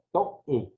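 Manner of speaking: toe in two syllables, to-É; broken diphthong. Shown as a demonstration. Teacher correcting the word, toe split as to-É. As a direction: split toe and stress the final e. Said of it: "yo lo tengo que acentuar".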